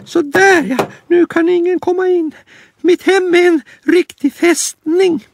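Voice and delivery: very high squeaky bunny, pleased with himself; a tiny rabbit boasting of his fort; proud little rabbit. Acted. Lille Skutt admiring his boarded-up home, high proud squeak.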